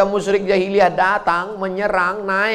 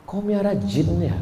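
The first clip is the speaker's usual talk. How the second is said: mother teasing; 4 dB quieter than usual. Retold or imitated. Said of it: "dia nanya".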